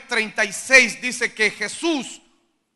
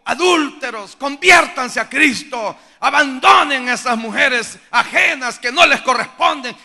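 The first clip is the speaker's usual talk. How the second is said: preaching rebuke; 3 semitones higher, 7 dB louder than usual. Retold or imitated. Retelling stadium sermon rebuke.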